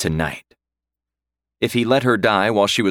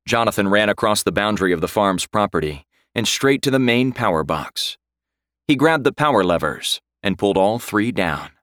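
usual speaking voice

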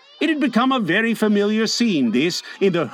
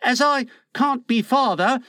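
heavily; 7 semitones higher than usual. A narrator's reading. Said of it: "he said heavily".

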